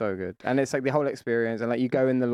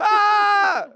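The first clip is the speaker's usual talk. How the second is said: high shriek; 8 dB louder than usual. Acted. Mock horror scream.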